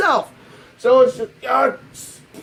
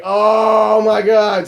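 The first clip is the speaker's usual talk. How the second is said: drawn-out amazement; much slower than usual. Retold or imitated. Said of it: "I was like".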